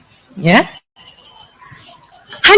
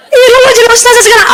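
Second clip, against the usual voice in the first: higher, laughing ribbing from a friend; laughing tease; 8 semitones higher, faster than usual. Retold or imitated. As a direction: rib her with a laugh. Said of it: "antara courageous dengan sombong". (teman menggoda sambil tertawa)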